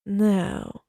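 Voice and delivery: ordinary delivery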